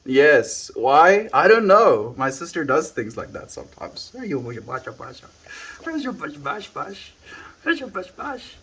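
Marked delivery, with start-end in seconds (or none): 4.1-8.5 pet talk. Real, not acted. Streamer teasing his pet about its toy, high coo. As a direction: tease the dog in a high coo.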